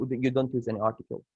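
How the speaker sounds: ordinary talk, nothing marked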